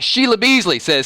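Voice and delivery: ordinary delivery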